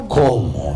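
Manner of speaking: "cómo" swooping down low; stress on CÓMO. Shown as a demonstration. Preacher pointing out the missing word, "cómo" low and drawn out. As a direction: draw "cómo" out on a deep dip. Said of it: "Ahí está diciendo".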